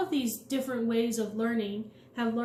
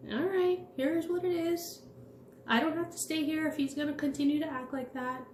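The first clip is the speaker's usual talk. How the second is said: higher, detached and unbothered, no energy; 6 semitones higher than usual. Retold or imitated. Walking out on the screaming manager, a higher detached voice with no energy. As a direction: lay it out calmly, refusing to engage. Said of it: "No energy on it".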